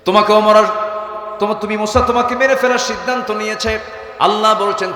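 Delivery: high, loud sermon cry; impassioned high-pitched preaching; full-force preaching. Acted. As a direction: raise the voice and proclaim it to the crowd.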